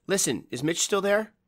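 high, urgent question. A narrator's reading. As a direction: press him, worried. Frightened woman asking urgently.

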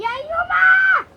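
mock angry yell. Host yelling at the naughty toy, mock angry.

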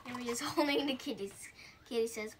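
plain reading voice

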